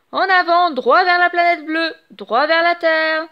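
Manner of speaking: loud, high rallying cry; the new captain rallies his crew; triumphant call. A narrator's reading. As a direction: launch the ship with glee. Setting sail to Earth.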